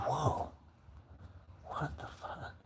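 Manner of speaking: hushed, amazed reaction; stunned whisper. Retold or imitated. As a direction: whisper it as if floored. Stunned by son's insight.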